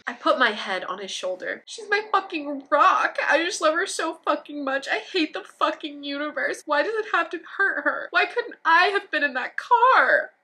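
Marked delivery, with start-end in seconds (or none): 1.6-10.3 mock sobbing. Sarah sobbing in story.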